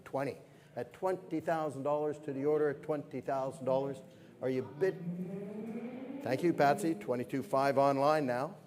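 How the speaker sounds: brisk, lively auctioneer calling bids; fine art auctioneer. Acted. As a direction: keep the bids rolling like a gala auction.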